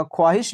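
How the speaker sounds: ख्वाहिश with the waw sounded; as it is written. Shown as a demonstration. Teacher repeats the spelling, ख्वाहिश with audible w. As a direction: say ख्वाहिश as khwaahish. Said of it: "लिखी जाती है".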